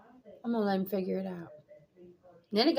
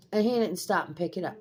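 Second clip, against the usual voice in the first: ordinary delivery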